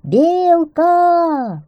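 high, loud, long-held call; drawn-out holler. A narrator's reading. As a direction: summon someone from across the forest. Hedgehog calls squirrel through woods.

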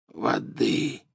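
put-on hoarse, soft croaking old man. A narrator's reading. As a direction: croak it weakly. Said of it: "прохрипел Дамблдор". (хриплый шёпот)

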